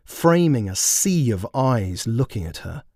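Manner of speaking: usual speaking voice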